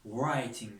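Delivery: ordinary delivery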